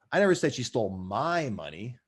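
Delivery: "my" stretched with a swoop in pitch; stress on my. Shown as a demonstration. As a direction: lean on the word "my". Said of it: "depending on where I put the emphasis".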